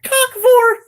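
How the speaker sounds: high, loud shout of a man breathless with laughter; laughing shout. Retold or imitated. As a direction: blurt it out at the top of your voice.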